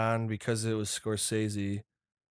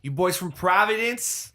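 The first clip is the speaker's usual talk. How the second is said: higher, louder hammed-up Boston accent; mock Boston tough guy; 7 semitones higher, 4 dB louder than usual. Quoting a Departed line in a hammed-up Boston accent. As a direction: quote the movie like a Boston cop.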